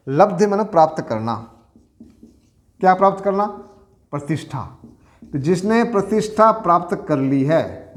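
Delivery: usual speaking voice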